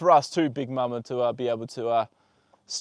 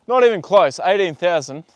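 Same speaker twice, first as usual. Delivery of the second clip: louder, punchy and indignant; emphatic refusal; 3 semitones higher, 9 dB louder than usual. Real, not acted. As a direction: shut the offer down hard. Rejecting lowball offers on horse.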